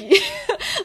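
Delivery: a sudden high-pitched laugh. Real, not acted. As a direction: laugh at the irony of his fear. Laughs at the irony.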